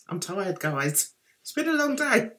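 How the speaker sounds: normal talking voice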